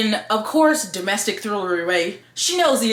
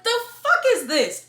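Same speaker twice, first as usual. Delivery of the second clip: exasperated disbelief; 12 semitones higher than usual. Retold or imitated. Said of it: "and I was like".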